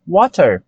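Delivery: "water" with a crisp true T; British pronunciation. Shown as a demonstration. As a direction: sound the T fully in "water". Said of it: "A mesma coisa acontece".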